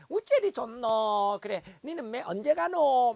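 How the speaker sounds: loud Gyeongsang dialect gossip; Gyeongsang chatter. Retold or imitated. Moms gossiping in a cafe, loud Gyeongsang accent.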